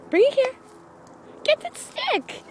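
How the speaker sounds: very high squeaky fetch call; high-pitched dog call. Real, not acted.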